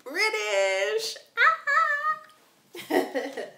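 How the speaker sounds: high, loud, drawn-out cheer; excited exclamation. Real, not acted. Answering the accent question with delight, excited shout. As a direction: belt it out, high and long.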